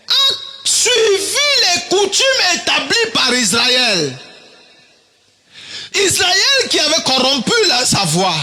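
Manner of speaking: high, emphatic preacher's declaration; preaching. Acted. Preacher accusing Israel of corruption, high emphatic preaching.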